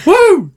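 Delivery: high-pitched celebratory "woo"; excited whoop. Real, not acted. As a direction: let out a high cheering whoop. Cheers show being back on.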